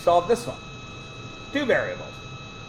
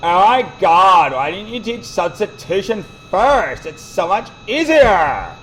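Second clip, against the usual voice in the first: mock whining student. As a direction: play a complaining teenager, half-joking. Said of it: "guess what every punk kid does".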